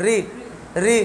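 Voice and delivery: री with the tongue forward by the teeth; light everyday री, tongue not curled; dental ri. Shown as a demonstration. Teacher showing where tongues wrongly hit, forward री. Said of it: "दांत पे टकरा रही है".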